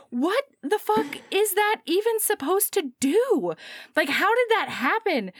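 high, incredulous mock outrage; acted bewildered demand; baffled outburst. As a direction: swoop up high in disbelief. Voicing her baffled outburst.

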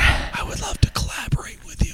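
breathy pickup line, teasingly whispered; mock seductive whisper. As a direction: whisper it close in her ear. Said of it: "let's pretend we're in a nightclub".

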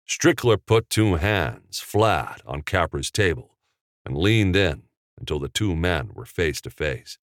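ordinary delivery, nothing marked

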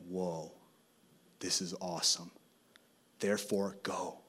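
a hint of an impressed pastor, low and soft; pastor's voice. Pastor walks in on praying students, lightly voiced.

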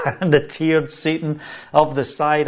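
plain reading voice

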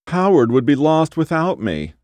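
high, insistent whine; whiny wife. A narrator's reading. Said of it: "the whiny voice".

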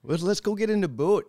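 high, hammed-up Minnesotan with a long O; mock Minnesota accent. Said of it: "I give my family crap about it".

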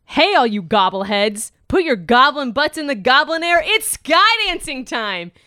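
hammed-up hype host, big yeehaw squeal up top; wacky announcer hamming up a podcast opener; over-the-top podcast host intro. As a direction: play a goofy fantasy podcast host firing up the crowd. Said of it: "Should I do a funny one?".

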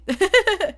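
a short high burst of laughter; bright laugh. Real, not acted. Vlogger laughing at her own advice, a high short laugh. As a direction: laugh at your own punchline.

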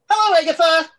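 high, loud, sing-song hello; cheery greeting. Acted. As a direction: greet her brightly. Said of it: "and he's like".

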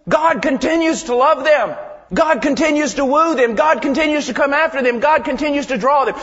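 loud, high, driving pulpit voice; fiery preaching. Acted. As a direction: hammer the point home to the men.

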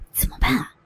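worried hushed question; anxious, low-voiced. A narrator's reading. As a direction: ask anxiously under the breath. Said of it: "焦急地低声问道".

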